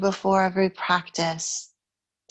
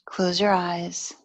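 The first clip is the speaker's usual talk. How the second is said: calm meditation guide, unhurried with long pauses; guided meditation; 3 dB quieter than usual. Real, not acted. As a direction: ease the listener into stillness, evenly and gently.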